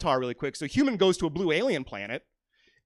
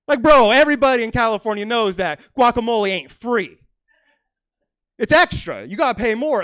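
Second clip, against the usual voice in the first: louder, higher, exasperated rant; 5 semitones higher, 8 dB louder than usual. Acted. Comic ranting at the guacamole customer, loud and exasperated. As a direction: rant at him like he's clueless.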